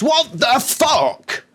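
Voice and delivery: high, very drawn-out what the fuck; stunned disbelief. Real, not acted. Reviewer reading the plan, stunned and drawn out. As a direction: let the disbelief stretch out. Reacting to the plot.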